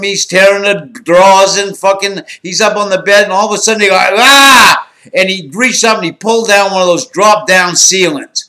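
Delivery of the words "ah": higher, louder startled yelp; short retold cry of shock; imitating his scream as the ceiling falls; mock scream. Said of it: "he screamed".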